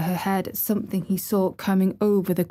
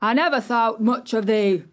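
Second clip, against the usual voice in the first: put-on angry old gardener; 3 semitones higher than usual. A narrator's reading. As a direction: scold her from the wall. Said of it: "he ranged".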